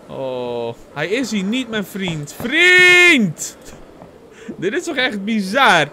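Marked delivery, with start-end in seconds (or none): 2.4-3.6 loud, high, stretched call. Acted. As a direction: holler for a friend out of sight. Calling for the lost friend.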